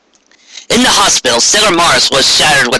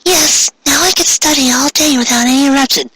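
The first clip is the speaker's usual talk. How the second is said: put-on high, prim girlish voice; eager little bookworm, pleased with herself; studious girl; 11 semitones higher than usual. Blue-haired girl to her friends about studying: high prim voice.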